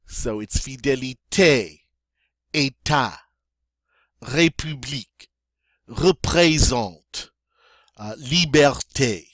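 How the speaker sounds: fidélité, État, République, représente, liberté with a long ay é; clear é sound. Shown as a demonstration. Teacher drilling the é vowel. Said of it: "It's always that A sound".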